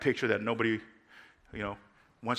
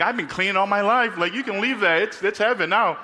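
higher, louder comic insistence; mock exasperation; 8 semitones higher, 5 dB louder than usual. [name] telling the girl to leave the dishes, in mock exasperation.

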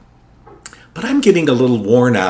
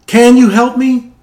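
much higher, louder pleading repeat; desperate plea; 12 semitones higher, 8 dB louder than usual. Retold or imitated. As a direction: beg harder, voice climbing. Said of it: "So he pleaded".

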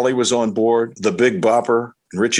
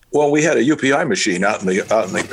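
ordinary talk, nothing marked

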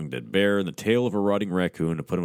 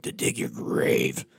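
slow, hushed monster whisper; soft intoning; 5 semitones lower, slower than usual. Acted. Rawhead answering the hunter, slow whispered menace. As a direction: breathe the threat slowly, like a spirit. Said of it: "Rawhead intoned softly".

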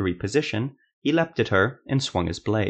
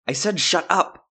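furious; 9 semitones higher than usual. Acted. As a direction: shout it down, done arguing. Silva repeats order, furious.

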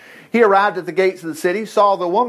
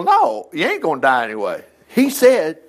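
normal talking voice

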